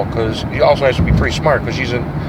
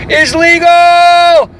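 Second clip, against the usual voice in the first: mock announcer; 8 dB louder, much slower than usual. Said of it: "sudden high slow voice".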